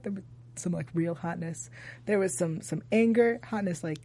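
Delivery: dropped to a low breathy hush; sultry hush. Real, not acted. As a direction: tease it softly. Sultry teasing about hotness.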